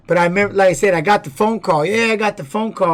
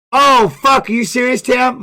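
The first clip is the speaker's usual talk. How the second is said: a real gasp of shock, higher and louder; real shocked reaction; 6 semitones higher, 4 dB louder than usual. Real, not acted. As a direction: caught off guard by sad news, louder. Reacting to chat's sad news.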